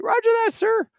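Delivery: very high-pitched, eager radio reply; urgent acknowledgement. Retold or imitated. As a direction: snap back a strained yes to the commander. Rookie answers order over radio.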